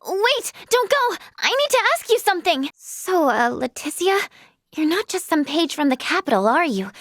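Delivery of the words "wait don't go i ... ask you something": higher-pitched plea to stop; urgent call. Acted. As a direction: call them back before they leave. Stopping someone who is leaving.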